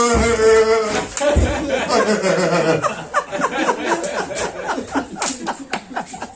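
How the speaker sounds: long real laugh trailing off; preacher laughing at his own impression. Real, not acted. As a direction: break into laughter. Laughs after mock crying.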